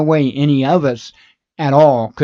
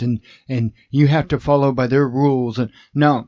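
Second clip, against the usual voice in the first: usual speaking voice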